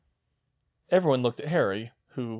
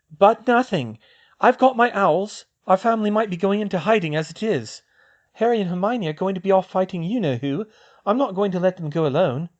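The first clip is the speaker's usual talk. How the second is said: put-on higher young woman's voice, brisk; girl's voice; 8 semitones higher, 6 dB louder than usual. Hermione planning with Luna, in a higher girl's voice. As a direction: voice the girl, a clear step up in pitch. Siblings argue, voiced high.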